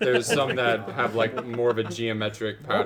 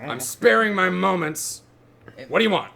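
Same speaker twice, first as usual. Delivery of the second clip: impatient blacksmith; 8 semitones higher, 6 dB louder than usual. Acted. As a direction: drag it out like an annoyed smith. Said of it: "he turns around and goes".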